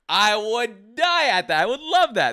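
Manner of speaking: ordinary talk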